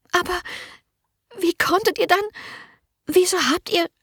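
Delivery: hohe, gehetzte Stimme einer verängstigten jungen Dienerin; drängend, voller Angst, hoch gesprochen; ängstliche Magd. A narrator's reading. Merle voiced high. (verängstigte junge Dienerin, drängend)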